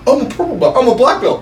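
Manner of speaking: high mocking boast; mocking brag. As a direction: ridicule both braggers. Mocking both men.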